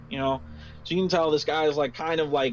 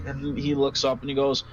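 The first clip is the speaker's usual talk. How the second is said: ordinary talk, nothing marked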